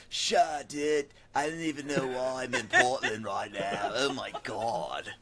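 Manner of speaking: lightly voiced laid-back surfer guy; surfer dude voice. Hinting at a Portland surfer dude for the cohosts. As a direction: drift through it like a stoned surfer. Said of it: "a Southern California surfer guy".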